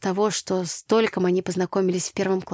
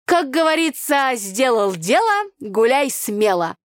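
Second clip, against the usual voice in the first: put-on loud, high folksy village girl; brassy country girl scolding; Tanya's brash voice; 6 semitones higher, 4 dB louder, slower than usual. Village girl Tanya, loud and brash.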